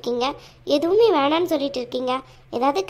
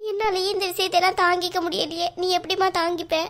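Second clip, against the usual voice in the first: weepy, higher-pitched lament; tearful; 4 semitones higher than usual. Acted. Mother fearing daughter cannot bear it, tearful. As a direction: despair for your daughter, acted weeping. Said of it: "ஒழுங்கா நீங்க முதல்ல அழாம".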